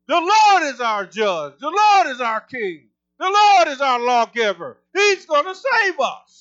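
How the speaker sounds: loud, drawn-out proclamation; prophetic decree. Shown as a demonstration. Preacher showing the congregation how to prophesy over the courthouse, decreeing. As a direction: stretch each line out at full volume. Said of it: "Just go stand there and prophesy it, decree it".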